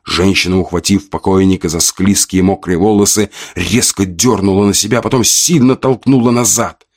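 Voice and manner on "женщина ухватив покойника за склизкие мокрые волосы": ordinary delivery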